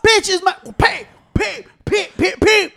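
high, slow mocking voice; mocking quote. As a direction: squeak it out, mimicking the mom. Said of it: "Y era like".

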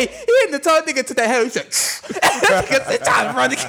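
very high, loud talk through laughter; real uncontrolled hilarity; laughing hard. Real, not acted. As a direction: gasp the joke out while cracking up. Cracking up at scene of chase.

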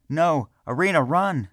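high, loud desperate warning; loud yell. A narrator's reading. Selvin warning Irina inside, high loud yell. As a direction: scream the warning to her. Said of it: "Selvin yelled, loudly".

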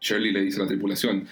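ordinary delivery

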